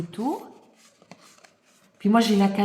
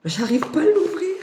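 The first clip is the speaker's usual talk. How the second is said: high strained voice while pulling; straining to open; 9 semitones higher, 4 dB louder than usual. Real, not acted. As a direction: strain as you pull the box open.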